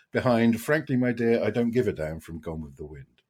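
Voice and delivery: usual speaking voice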